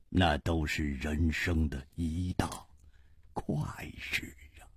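低沉轻声，慢慢倾吐; 压低嗓音，句尾渐成气声; 从容深沉的无花. Acted. Wuhua confides after smiling. (低声感慨，停顿悠长)

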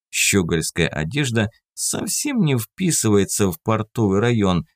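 ordinary delivery, nothing marked

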